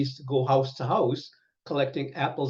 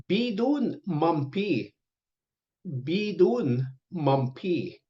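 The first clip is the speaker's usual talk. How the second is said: slow teaching pronunciation; 3 semitones higher, slower than usual. Shown as a demonstration. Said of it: "teaching command".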